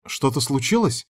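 mildly anxious question; touch of worry. A narrator's reading. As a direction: ask with understated concern. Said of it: "В голосе блондинки послышалась тревога".

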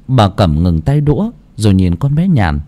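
usual speaking voice

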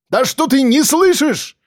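high-pitched exasperated outburst; slow, high, exasperated protest. A narrator's reading. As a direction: raise the pitch sharply in frustration. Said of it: "повысил голос Акбар".

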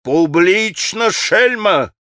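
high, drawn-out angry insult; furious cry, understated in volume; enraged shout. A narrator's reading. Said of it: "грозя ей кулаком, закричал".

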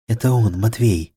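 plain reading voice

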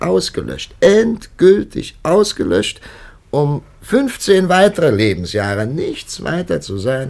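emphatic outburst. Acted. Priest's bitter outburst about lost years.

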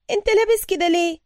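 high, loud voice of a startled woman. A narrator's reading. Woman suddenly speaking to the man calming her, startled. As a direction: pitch it way up, blurted out loud. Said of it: "ثم تكلمت فجأة".

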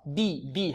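بي softly, as a pure "bee"; a quiet, clear i on بي; كسرة خالصة. Shown as a demonstration. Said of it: "كسرة خالصة". (باء بكسرة خالصة في بي)

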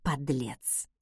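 very soft, low grumbled insult; barely audible mutter. A narrator's reading. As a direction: mutter it under your breath, pitched right down. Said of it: "едва слышно буркнула я". (еле слышно, буркнуть под нос)

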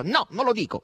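brusque, loud no; curt refusal. A narrator's reading. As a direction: refuse flatly and high. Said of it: "rispose asciutto Asasello".

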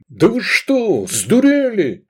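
loud, high, bewildered yell. A narrator's reading. Man fending off furious women, yelling. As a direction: yell in protest at the attackers. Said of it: "заорал Лобанов".

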